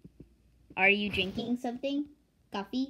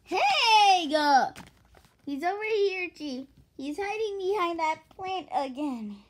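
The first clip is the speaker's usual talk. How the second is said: higher opening call, then easing off; agitated story character; 9 semitones higher, 9 dB louder than usual. Acted. Character spots someone hiding behind a plant, agitated.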